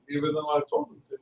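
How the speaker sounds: quick grumble to oneself; muttered aside. Acted. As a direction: toss it off under the breath. Muttered aside within king's speech.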